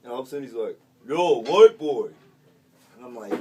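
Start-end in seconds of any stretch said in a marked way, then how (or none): 1.1-2.1 loud, drawn-out inmate's holler; calling out. Retold or imitated. Inmate at his cell door hails the new guy, calling out loudly. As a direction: holler it slow and high across the cellblock. Retold inmate calls out to him.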